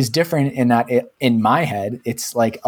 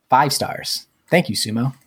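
ordinary delivery

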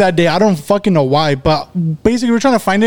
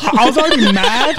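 laughing; 7 semitones higher than usual. Real, not acted. Laughing at friends' teasing.

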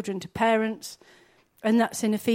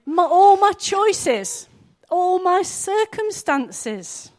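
impassioned cry; 6 semitones higher, 5 dB louder, slower than usual. Acted. Impassioned preaching.

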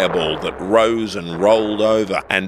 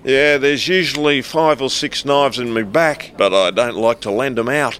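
higher-pitched sarcastic quip; quipping; 6 semitones higher than usual. Acted. Passenger answers the staffer's knife question, quipping. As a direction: toss it back as a smart-arse joke. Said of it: "I quipped".

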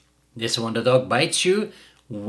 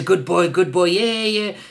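higher, eager praise; excited good boy praise; praising puppy; 7 semitones higher, 3 dB louder than usual. Retold or imitated. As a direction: cheer the puppy on. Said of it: "we say".

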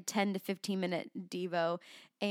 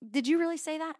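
mock-doubting, raised-pitch question; a touch of disbelief, pitched up; doubtful questioning; 8 semitones higher, 5 dB louder, faster than usual. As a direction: ask it higher and louder, as if challenging. Repeats the doubting question to God.

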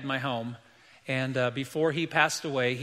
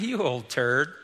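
laughing insult; 2 semitones lower, slower than usual. Retold or imitated. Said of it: "I thought".

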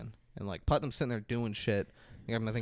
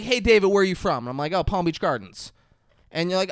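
higher, louder hammed-up condescension; mock snobby exchange; 5 semitones higher, 8 dB louder than usual. Host parodying the agent's snobbery with co-host as example, loud mocking voices. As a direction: play both sides of a smug small-talk exchange. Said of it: "who the fuck talks like that".

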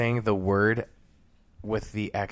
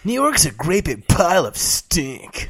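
slow, high, sneering cartoon-teen announce; nineties teen bully; 7 semitones higher, 6 dB louder, slower than usual. Announcing the segment in a sneering nineties bully voice. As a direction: sell it like a snotty nineties Nickelodeon kid. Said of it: "said that like a character from".

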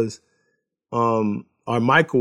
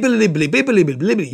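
high, louder bleep-bleep noises standing in for curses; censor bleeps; 6 semitones higher, 3 dB louder than usual. Retold or imitated. Husband bleeps out the angry driver's curses, playful high bleeping. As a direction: beep out her swearing like a TV censor.

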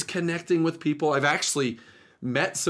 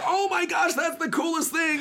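high excited squeal, much higher; excited rider. Retold or imitated. Rider hears about the podcast, excited high squeal. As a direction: gush like a thrilled fan.

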